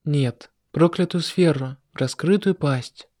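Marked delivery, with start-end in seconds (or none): none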